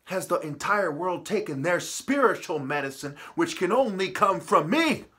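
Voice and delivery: normal talking voice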